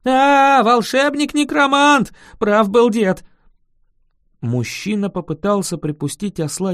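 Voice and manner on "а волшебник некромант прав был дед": high, loud alarmed exclamation; panicked cry. Acted. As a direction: cry out as your worst fear is confirmed. Panicked peasant shouts at supposed necromancer.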